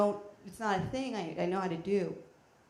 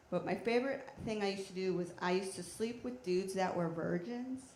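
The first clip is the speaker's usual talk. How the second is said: ordinary talk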